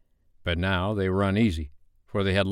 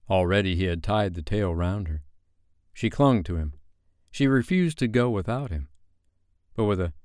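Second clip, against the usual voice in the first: plain reading voice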